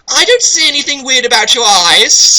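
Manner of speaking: put-on high, loud puzzled girl; confused girl character. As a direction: sound baffled in a girly pitch. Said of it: "a very confused Knight".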